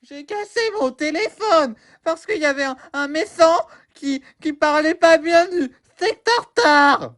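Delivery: put-on high wailing little kid; crying child. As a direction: sob to daddy like a small child.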